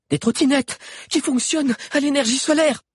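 high, breathless explanation; without pausing for breath. A narrator's reading. Lunella explaining while fleeing, without catching breath. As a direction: rush it out mid-run. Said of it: "sans reprendre sa respiration".

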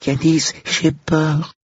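put-on high, slow timid child; small high voice, drawn out; frightened little girl. A narrator's reading. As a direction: murmur it like a scared small girl. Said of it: "murmura la copine de sa petite sœur".

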